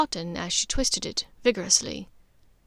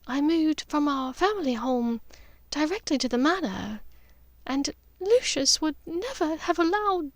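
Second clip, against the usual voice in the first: pained Narcissa; 6 semitones higher than usual. A narrator's reading. Narcissa voiced, pained explanation.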